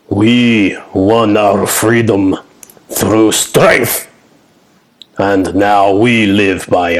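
slow, heavy, commanding chieftain. Acted. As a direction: speak like a proud giant.

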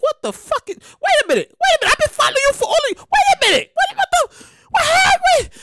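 high, incredulous, mock-outraged fan; shocked fan. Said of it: "They be like".

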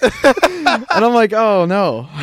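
laughing oh no. Real, not acted. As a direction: giggle out the words.

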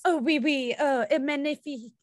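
posh mock French accent; lightly imitating a French accent. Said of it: "her talking in, like, Paris".

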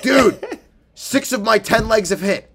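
high, loud excited burst; mock thrilled friend. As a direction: blurt it out like big news. Friend excitedly retelling parlay news.

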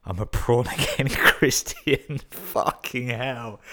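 high, loud, breaking into real laughter; helpless laughter through the words; laughing while reading. Real, not acted. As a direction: crack up at your own punchline. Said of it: "I laughed when I read that".

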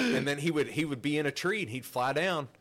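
ordinary talk, nothing marked

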